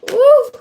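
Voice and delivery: high whooping cheer; excited woo. Real, not acted. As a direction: let out a loud happy woo. Cheer before pulling cards.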